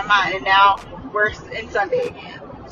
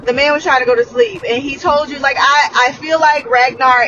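heated; 4 semitones higher, 6 dB louder than usual. Real, not acted. Heated rant.

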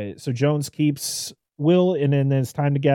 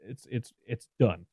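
ordinary talk

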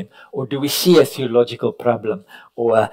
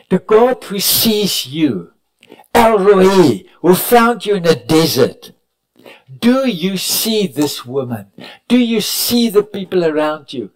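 rising preaching; 6 semitones higher, 5 dB louder than usual. Acted. Preacher urging the congregation to see others, preaching at full force. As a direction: lift the crowd toward the question.

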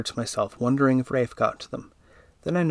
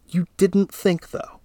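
scolding; 7 semitones higher, 3 dB louder, slower than usual. Acted. Husband scolding wife.

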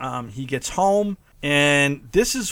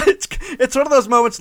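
high laughing squeal into the words; genuinely cracking up; real laughter; 12 semitones higher, 3 dB louder, faster than usual. Real, not acted. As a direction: laugh hard while you talk. Laughing hard.

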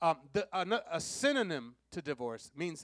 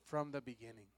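quiet answer; 7 semitones lower, 9 dB quieter than usual. Real, not acted. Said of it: "But it was not this way from when?".

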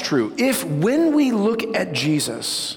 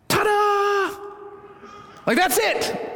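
a big, high "ta-da" shout, showman style; mock fanfare. Preacher playfully unveiling the big reveal, high showman shout. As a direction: announce the reveal like a magician.

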